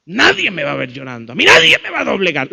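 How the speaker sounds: low growing to a loud, fast outburst; acting a defiant woman, building sharply; hardened defiance. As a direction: swell from held-in to fierce refusal. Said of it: "se endurecen y dicen".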